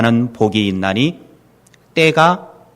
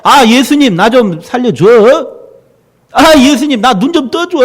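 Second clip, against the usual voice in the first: higher, louder begging shout; desperate pleading cry; 10 semitones higher, 4 dB louder than usual. Retold or imitated. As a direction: beg for rescue at the top of the voice. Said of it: "이랬겠습니까? 아주 막 소리 지르지". (높고 크게 살려달라 애원하는 외침)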